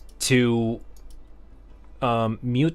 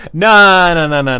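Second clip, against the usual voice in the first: emphatic loud no; 6 semitones higher, 11 dB louder than usual. Real, not acted. Rejecting loud chewing on stream.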